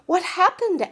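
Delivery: high, loud startled cry; alarmed question. Acted. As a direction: ask it in alarm. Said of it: "asked another man".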